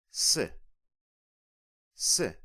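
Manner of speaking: "Se" said alone, short and clear; isolated syllable. Shown as a demonstration. Teacher isolates the prefix "Se", said crisp and clear. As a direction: give "Se" on its own, cleanly. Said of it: "let's listen a bit closer".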